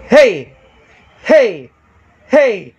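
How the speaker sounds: high, loud hey shouted. Shown as a demonstration. Sound tech shouting to show the meter. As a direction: shout it hard into the mic. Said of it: "Sumigaw ako".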